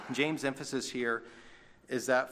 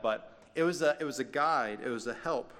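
normal talking voice

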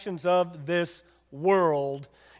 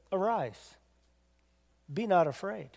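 soft, tender reassurance; calm the frightened disciples softly; gentle Jesus; 9 dB quieter than usual. Retold or imitated. Jesus gently lifting the fearful disciples, soft and tender.

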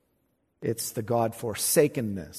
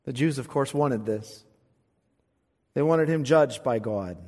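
normal talking voice

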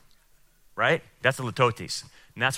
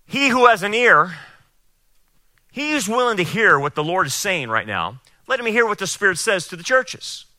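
scripture reading; 5 semitones higher than usual. Acted. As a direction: proclaim the verse to the congregation. Reads scripture aloud.

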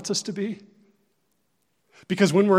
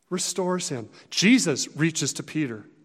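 ordinary talk

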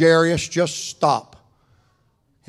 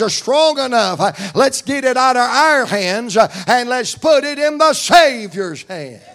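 rising, louder preaching cadence, each line punched; full-force preaching; 5 semitones higher, 4 dB louder than usual. Acted. Preacher rousing the congregation about God's hands, full-force preaching. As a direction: preach it to the rafters.

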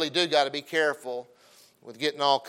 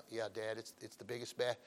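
much softer, low humble reply; humble son; 7 semitones lower, 16 dB quieter than usual. Retold or imitated. Humble son answers proud dad, soft and meek. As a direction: say it meek and barely audible. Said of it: "like, real humble".

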